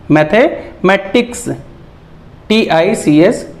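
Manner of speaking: "Mathematics" slowly, split as mathe-matics; slow reading demo of "mathematics" in chunks; broken into parts. Shown as a demonstration. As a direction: pause midway through "mathematics". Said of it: "इसे तोड़-तोड़ के पढ़ना होता है".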